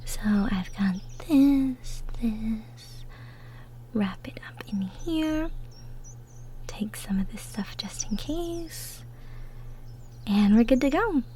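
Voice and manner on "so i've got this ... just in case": soft unvoiced whisper while packing. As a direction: breathe the words, slow and hushed. Packing picnic basket quietly.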